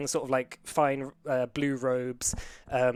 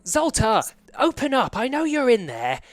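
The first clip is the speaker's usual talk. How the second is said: high, loud, demanding female voice; high-pitched insistent official banging to get in; angry woman's voice; 11 semitones higher, 6 dB louder than usual. Acted. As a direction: shout through a locked door as an outraged woman. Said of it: "like, a female's voice going".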